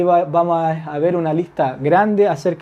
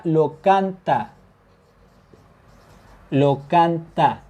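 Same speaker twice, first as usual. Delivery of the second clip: slow model word; much slower than usual. Shown as a demonstration. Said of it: "teacher models Turkish word".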